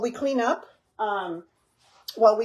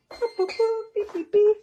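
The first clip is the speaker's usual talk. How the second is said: high playful beep-beep sounds, much higher than her usual voice; beeping noises; 12 semitones higher, 4 dB louder than usual. Pretend. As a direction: chirp it out like a timer going off. Imitating kitchen timer beeps.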